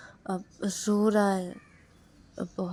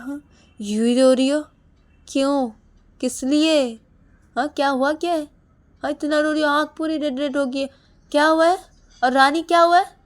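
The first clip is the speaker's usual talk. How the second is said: much higher, loud hammering questions; angry demand; 8 semitones higher, 10 dB louder than usual. Acted. Husband demanding why his wife cried, loud and angry. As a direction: keep demanding louder each time.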